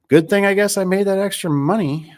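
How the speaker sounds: high and loud sarcastic cheer; sarcastic relief. Retold or imitated. Telling himself the broken truck is fine, sarcastic. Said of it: "And you're like".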